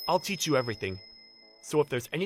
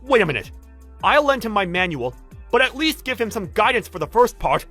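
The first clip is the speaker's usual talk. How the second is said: loud, raised-pitch objection; indignant protest; 7 semitones higher, 8 dB louder than usual. Acted. Worker demanding guidance for a newcomer, indignant. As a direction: insist he does his share.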